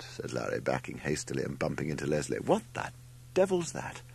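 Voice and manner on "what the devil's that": very high startled question. A narrator's reading. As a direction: recoil in alarm, voice shooting up. Larry backs away from the gull.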